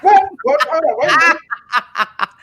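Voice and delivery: flustered giggling protest; laughing. Real, not acted. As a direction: stall for time while cracking up. Laughing at own mistake.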